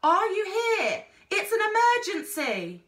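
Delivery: high, loud panicked call; frantic call into the dark; urgent calling. A narrator's reading. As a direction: shout that it's urgent. Boy calls into the vines.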